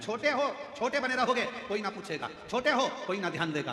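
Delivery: lightly acted taunt; mock taunting. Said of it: "लोग फिर ताना भी देना प्रारंभ करेंगे".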